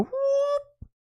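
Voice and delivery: very high, soft whoop; a light airy high whoop; mock spirit whoop. The Holy Spirit nudging her, a soft high whoop.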